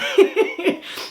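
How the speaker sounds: high airy giggle; breathy little laugh. Real, not acted. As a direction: let a short laugh slip. Laughing at story.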